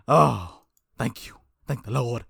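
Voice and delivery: usual speaking voice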